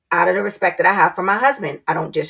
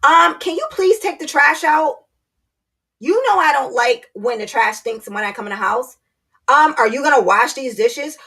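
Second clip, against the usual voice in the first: put-on higher, sing-song scolding of a child; talking down like to a kid; 5 semitones higher than usual. Wife talking to husband like a child, condescending and higher. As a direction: nag him like a mother to a toddler. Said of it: "talking to him like he's a kid".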